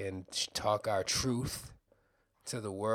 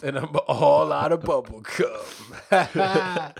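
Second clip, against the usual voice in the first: slow, loud tough-guy line; action hero quote; 4 semitones higher, 12 dB louder, much slower than usual. Retold or imitated. Movie quote performed.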